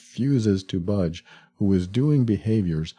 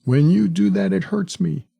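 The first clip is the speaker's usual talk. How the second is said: much higher, louder, wounded whine; an abuser putting on hurt to the partner, whiny; playing the victim; 10 semitones higher, 4 dB louder than usual. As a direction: pout it out to guilt them. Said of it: "the emotionally abusive person playing the victim".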